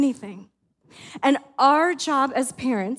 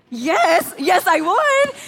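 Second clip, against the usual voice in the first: higher and louder, eager burst of yes; excited yes; 5 semitones higher, 4 dB louder, much slower than usual. Retold or imitated. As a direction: jump at the offer like a thrilled kid. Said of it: "you're getting too excited about a McFlurry".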